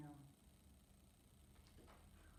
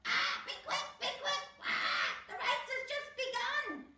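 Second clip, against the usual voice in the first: very high, louder bird voice with squawks; cockatoo squawk; 5 dB louder than usual. Acted. Cocky flying above urging them on, high squawking. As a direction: screech like an excited cockatoo.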